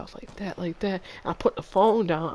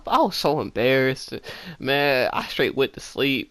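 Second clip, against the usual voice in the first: ordinary talk, nothing marked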